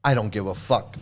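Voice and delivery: low, soft, shrugging mock; low muttered mockery; mock indifference. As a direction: mimic a careless follower. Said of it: "and you go".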